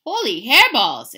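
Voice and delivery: loud, slow, high cry of shock; exclaimed. Acted. Skippy shocked at his head in the mirror, loud. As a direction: yelp at the mirror. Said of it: "exclaimed Skippy John Jones".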